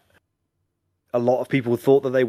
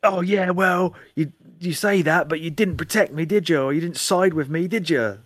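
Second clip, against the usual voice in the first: higher-pitched mock grievance; imitated reproach; 6 semitones higher than usual. Game character shooting at the player, imitated resentful comeback. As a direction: throw the accusation back, hammed up a little. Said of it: "she just kept being like".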